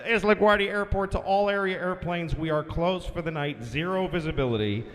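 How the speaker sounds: official announcement sliding steadily down into a low drone; deadpan controller bulletin, descending in pitch; airport controller. Retold or imitated. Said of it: "the LaGuardia guy comes".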